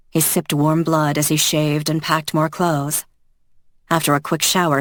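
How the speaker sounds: ordinary delivery, nothing marked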